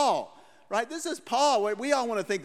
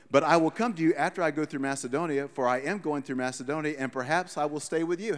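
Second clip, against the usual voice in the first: scripture reading; 4 semitones lower than usual. Acted. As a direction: read the verse out like from the pulpit. Preacher reads scripture aloud.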